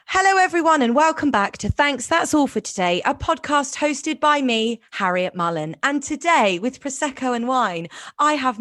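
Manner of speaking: bright, upbeat show opener; podcast host intro. Acted. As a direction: welcome the listeners like a radio host. Podcast intro read, host style.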